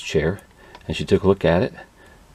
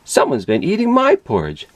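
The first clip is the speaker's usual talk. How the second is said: higher mama bear voice; 10 semitones higher than usual. A narrator's reading. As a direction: ask it like a puzzled mother bear.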